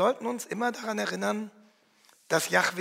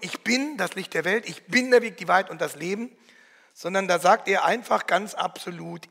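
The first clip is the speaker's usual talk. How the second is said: normal talking voice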